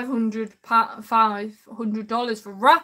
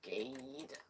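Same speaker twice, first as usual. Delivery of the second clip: whispered; 22 dB quieter than usual. Shown as a demonstration. Typing name, trying out word.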